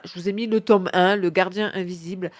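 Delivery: ordinary delivery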